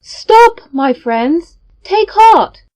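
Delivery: high, loud call to stop the crowd; cried out. Acted. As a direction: shout them back from the edge. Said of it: "cried out to his companions".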